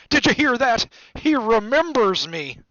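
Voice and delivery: high, loud jubilation, slowing proudly; excited cry. A narrator's reading. Diggle boasting to the room, jubilant cry. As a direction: announce it triumphantly to everyone. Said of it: "cried Daedalus Diggle, looking around".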